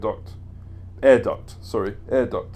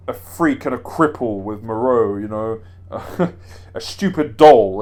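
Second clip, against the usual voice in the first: slow, louder sneering villain voice with a laugh; Heisenberg impression; 5 dB louder, slower than usual. Heisenberg mocking Moreau, slow theatrical sneer. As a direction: drag out the insult like a theatrical baddie. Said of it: "that's how he talks".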